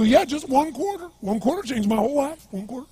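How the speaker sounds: high-pitched mock beggar; strange, high begging voice; bizarre drunk voice. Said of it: "really bizarre looking sounding voice".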